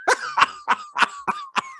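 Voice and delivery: very high, rapid cackle; high staccato laugh; imitated laughing. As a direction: cackle in short high bursts as the villain. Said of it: "Dante's like".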